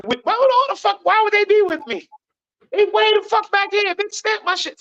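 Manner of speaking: high, heated disbelief; indignant. Real, not acted. Indignant rant about racism.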